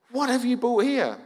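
high-pitched mock outrage, drawn out; indignant retold demand; mad wife. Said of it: "and she was mad".

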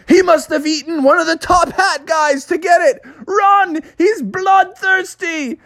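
very high, crying shout of alarm; frantic warning. Acted. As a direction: yell a terrified warning to everyone. Someone warns of the bear.

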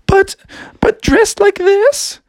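high, breathy-free lilting princess. Acted. Princess telling Froggy about her sisters, high voice. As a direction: play the upset princess in a high voice. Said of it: "she's like".

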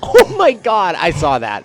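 loud, high-pitched cry of awe; amazed outburst. Real, not acted. Player witnessing friend's great save, loudly amazed. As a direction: burst out in amazement.

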